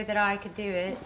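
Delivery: amateur stab at an Australian accent; attempted Australian accent. Shown as a demonstration. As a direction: try on an Australian accent, half laughing. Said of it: "go do your accent".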